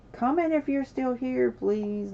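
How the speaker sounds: high, loud call to chat. Real, not acted. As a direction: call out to the viewers. Calling out to the chat.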